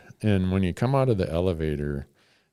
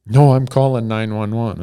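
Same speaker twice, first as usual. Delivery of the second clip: louder, firm insistent refusal; firm clerk; 3 semitones higher, 8 dB louder than usual. Retold or imitated. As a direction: put your foot down as the worried clerk. Clerk refuses to call an Uber.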